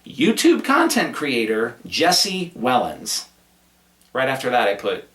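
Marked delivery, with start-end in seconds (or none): 0.0-3.3 slow, high, mock-grand "YouTube content creator Jesse Wellens"; all in caps. Shown as a demonstration. Host repeating the note, exaggerated all-caps stress on "YouTube content creator Jesse Wellens". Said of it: "I have that all in caps".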